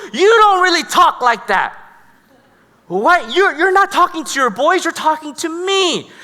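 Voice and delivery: high-pitched indignant scolding, imitated; fuming mad. Girlfriend scolding her boyfriend before his boys, fuming. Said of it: "she's fuming mad, and she goes".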